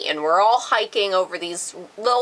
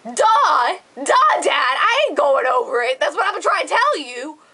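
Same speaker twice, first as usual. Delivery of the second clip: higher, forceful mock exasperation; raised-pitch "I told you so"; exasperated retort; 6 semitones higher, 3 dB louder than usual. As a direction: act out fed-up daughter telling dad off. Retold exasperated retort to dad.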